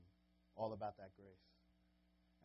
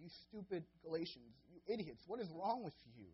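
higher and louder, berating the crowd; angry scolding; 6 semitones higher, 6 dB louder than usual. Retold or imitated. Paul berating the foolish Galatians, loud and angry. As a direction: tear into them as idiots. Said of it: "He's calling them idiots".

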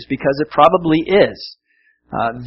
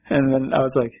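ordinary talk, nothing marked

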